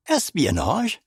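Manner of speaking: high gasped echo; startled gasp on espionage. A narrator's reading. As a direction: gasp out the word in shock. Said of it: "I gasped".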